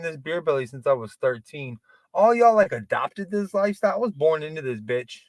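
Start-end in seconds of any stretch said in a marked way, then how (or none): none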